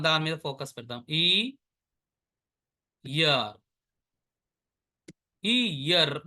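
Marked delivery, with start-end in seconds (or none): 1.2-3.6 ఇయర్ broken into "ee", long pause, then "yar"; split pronunciation. Shown as a demonstration. Teacher showing how to pronounce the word, split in two. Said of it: "దీన్ని ఎలా pronounce చేస్తాము". 5.4-6.1 ఇయర్ as "ee-yarr", ending on a trilled r; rolled-r pronunciation. Shown as a demonstration. Teacher demonstrating the word's sounds, final r rolled. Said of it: "ర్ర, రా తీసుకొని".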